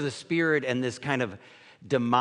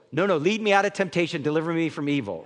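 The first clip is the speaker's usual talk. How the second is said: hurried pleading prayer; 3 semitones higher, 4 dB louder, faster than usual. Retold or imitated. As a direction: rattle it off in nervous haste. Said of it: "Old Christians go".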